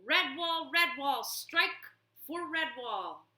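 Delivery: high, loud roaring rally; battle cry. Acted. Warrior rallying the defenders, loud battle cry. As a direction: roar it as swords rise. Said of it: "he roared out his battle cry".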